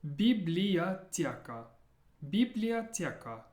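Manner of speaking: "Biblioteca" drawn out slowly, part by part; working through slowly. Shown as a demonstration. Teacher helping learners through a long word, slow and careful. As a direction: take "Biblioteca" slowly, each syllable separate. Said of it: "work through it letter by letter".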